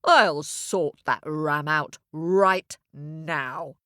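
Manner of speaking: slow, firm cow voice; determined cow. Acted. Cow promises to sort the ram out, slow and determined. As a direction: promise it slowly and firmly. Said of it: "said the cow".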